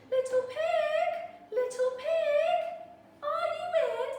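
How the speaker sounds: high, sing-song sweet call. Retold or imitated. Wolf politely calls at the straw house, high and sing-song. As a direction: croon it up to the door like a sweet visitor. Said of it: "so I called".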